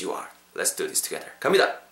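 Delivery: usual speaking voice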